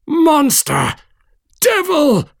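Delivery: high screamed insults at the monster; screaming. A narrator's reading. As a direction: scream Devil! in terror and rage. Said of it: "I screamed".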